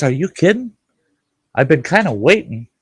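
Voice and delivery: high, loud disbelieving are-you-kidding; a judge who can't believe what he's hearing; incredulous judge. Retold or imitated. Judge to defendant who already paid, high and incredulous.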